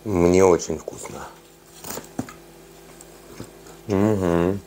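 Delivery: chewing while talking; speaking between bites; mouth full. Real, not acted. Seen 3: bites nugget at 1.0s, chews with closed mouth throughout the words.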